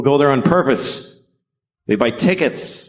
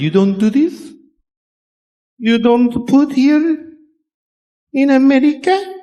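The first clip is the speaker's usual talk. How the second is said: mock French accent. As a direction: play the French girlfriend, puzzled by Americans, in a high mock French accent. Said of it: "She said".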